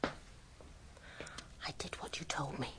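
slow, conspiratorial whisper; slow hushed whisper to Poirot. Acted. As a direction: report back in secret, barely voiced. Secretly reporting after the staged cry.

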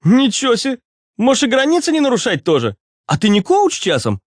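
very high, exaggerated mock amazement; mocking retort. A narrator's reading. (издевательски, очень высоким голосом)

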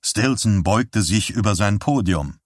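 usual speaking voice

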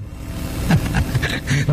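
breathy chuckle. Real, not acted. Preacher laughs at own story.

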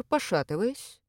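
ordinary delivery, nothing marked

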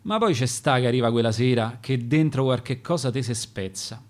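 ordinary delivery

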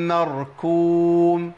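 مركوم drawn out very slowly and loud, with a light kaf; teacher's slow recitation contrasting kaf with qaf; kaf recited. Shown as a demonstration. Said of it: "بالكاف فين".

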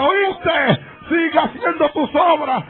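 high shout across the street; shouting from afar. Retold or imitated. Drunk man shouts from the bar across the plaza, high yell. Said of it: "Y desde allá me grita".

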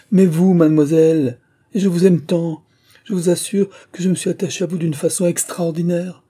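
high, impassioned declaration; ardent protest. Acted. Suitor protesting his attachment to his beloved's sister, ardently. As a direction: plead your affection with fervour.

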